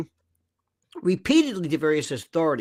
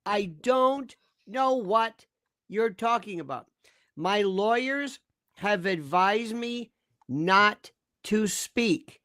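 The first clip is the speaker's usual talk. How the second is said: slow, measured refusal with gaps; deliberate stonewalling; 4 dB quieter, slower than usual. Acted. Lawyer models a client refusing comment, slow and deliberate. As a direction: spell it out slowly, phrase by phrase.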